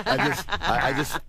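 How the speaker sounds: high, breaking into laughter; can't get the words out for laughing. Real, not acted. Laughing at own joke.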